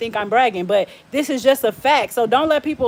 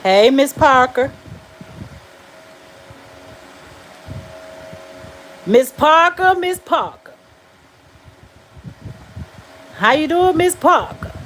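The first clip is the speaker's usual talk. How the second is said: calling out. Pretend. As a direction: holler a hello, pitch climbing. Calls out greeting to Miss Parker.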